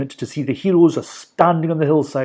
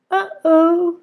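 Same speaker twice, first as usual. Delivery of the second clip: put-on worried girl; 3 dB louder than usual. A narrator's reading. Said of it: "said Polly".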